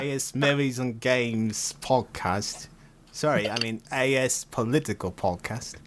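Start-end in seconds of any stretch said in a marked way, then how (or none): 0.0-2.7 put-on slow, drawn-out English accent. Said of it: "I should adopt an English accent".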